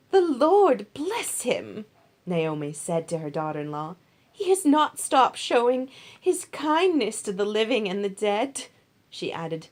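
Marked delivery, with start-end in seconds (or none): 0.1-1.9 higher, louder, very slow blessing; fervent blessing. Acted. Naomi blesses Boaz. 4.3-8.7 higher and louder, full of praise; a delighted old woman praising kindness; Naomi, grateful mother-in-law. Acted.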